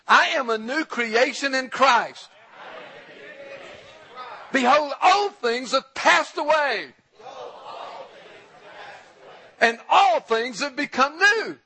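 loud, high preacher's proclamation; ringing full-voice declaration, pitched well up; fired-up declaration. Shown as a demonstration. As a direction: shout it out to lead the congregation. Fired-up second take of the declaration.

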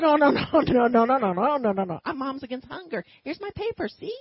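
nervous protest to the angry men; retold frantic denial. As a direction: wave them off, half laughing.

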